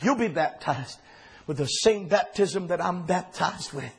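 compassionate. Acted. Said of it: "with such compassion".

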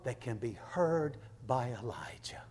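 low, soft, nearly whispered preaching; hushed awe. Real, not acted. Hushes over God's small voice.

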